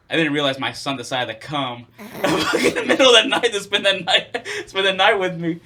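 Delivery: can barely get it out for laughing. Real, not acted. Laughing while telling the story.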